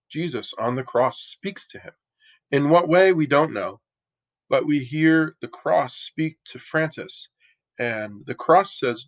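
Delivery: normal talking voice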